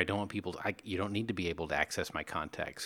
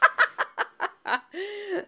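high laugh; 3 dB louder than usual. Real, not acted.

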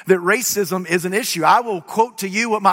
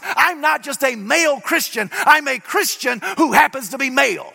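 full-force preaching; 6 semitones higher than usual. Acted. Preacher proclaiming the resurrection to his congregation, full-force preaching. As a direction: preach it hard to the crowd.

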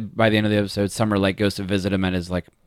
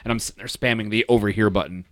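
normal talking voice

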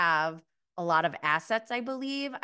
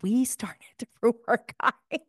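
laughing, louder confession; 4 semitones higher, 6 dB louder than usual. Real, not acted. Teasing the housewives, laughing as she says it. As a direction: laugh through the joke admission. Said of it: "the whole time going".

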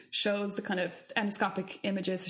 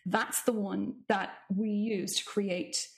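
usual speaking voice

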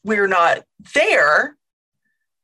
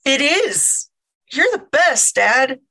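higher-pitched mock admiration; sarcastic; 4 semitones higher than usual. Child humouring dad's survival advice, sarcastic. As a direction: gush with fake awe at an obvious idea.

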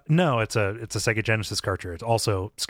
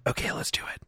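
soft conspiratorial whisper; 7 dB quieter, faster than usual. Acted. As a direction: whisper like you're plotting. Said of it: "you're gonna have to whisper a little louder".

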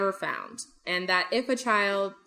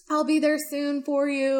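imitated tearful crying; 6 semitones higher than usual. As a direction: imitate a sister tearfully reassuring her brother. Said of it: "starts crying on the phone".

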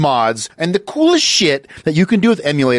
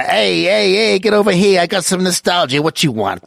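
higher-pitched street dealer hawking the goods; nostalgia dealer; 5 semitones higher than usual. Pretend. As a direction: play a dealer calling a customer over. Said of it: "He is your local nostalgia dealer".

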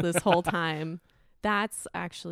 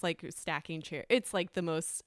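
ordinary talk

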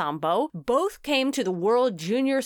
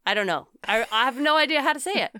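ordinary talk, nothing marked

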